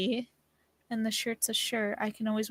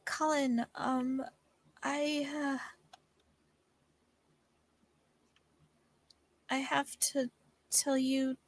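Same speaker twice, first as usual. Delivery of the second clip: nervous; 2 semitones higher, 3 dB quieter, slower than usual. Acted. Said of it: "I got so nervous being around you".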